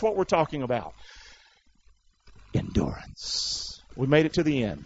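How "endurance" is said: slow, soft, whispered key word; hushed emphasis. Real, not acted. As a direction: draw it out in a whisper. Drawn-out closing key word.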